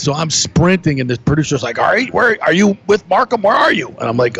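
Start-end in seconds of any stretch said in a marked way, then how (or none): 1.8-3.9 high urgent question, hurried; frantic voice asking where; frantic producer. Retold or imitated.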